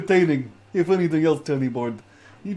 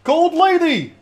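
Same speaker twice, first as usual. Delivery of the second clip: calling out; 12 semitones higher, 6 dB louder, slower than usual. Real, not acted. Calling out to Luma.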